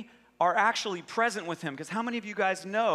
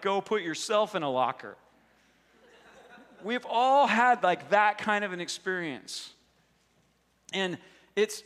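ordinary delivery

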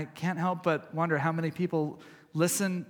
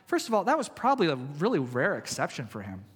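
ordinary talk

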